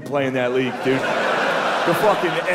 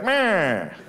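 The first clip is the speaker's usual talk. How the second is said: put-on high old-timey radio announcer voice; 8 semitones higher than usual. Comic imitating an old radio announcer, pitched high. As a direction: call it like a vintage ballgame broadcaster. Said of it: "You can hear the announcers".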